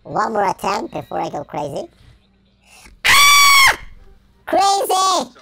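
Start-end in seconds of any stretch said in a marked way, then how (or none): 3.0-3.8 high, loud startled scream; sudden scream. Real, not acted. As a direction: let out a sharp yell of panic. Throw attempt, sudden scream.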